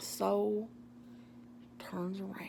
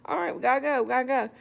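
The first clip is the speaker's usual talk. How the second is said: high-pitched, placating repeated exit; lifted voice, humouring while trying to escape; polite brush-off; 6 semitones higher, 4 dB louder than usual. Retold or imitated. Walker trying to take off with dogs, polite brush-off.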